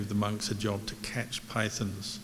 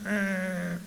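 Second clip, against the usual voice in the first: a sudden, much higher "Ah" like a monkey screeching; 10 semitones higher than usual. Retold or imitated. Monkey screeching at the python from the tree. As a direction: shriek it the way the angry monkey did. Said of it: "screaming at the python".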